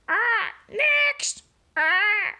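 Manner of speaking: very high squawking parrot. Acted. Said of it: "ordered the parrot".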